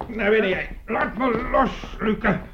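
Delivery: high, strained orders while struggling; straining command. Acted. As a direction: push the words out while wrestling free. Struggle, ordering him inside. (gespannen bevel tijdens een worsteling, hoog)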